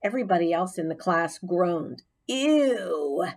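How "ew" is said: disgusted. A narrator's reading. Class groans in disgust.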